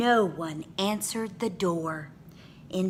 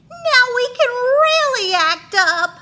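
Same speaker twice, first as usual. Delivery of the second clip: put-on loud, very high gleeful kid voice; 12 dB louder, faster than usual. A narrator's reading. Kids plotting mischief in class, gleeful excited voice. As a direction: cheer like mischief-makers.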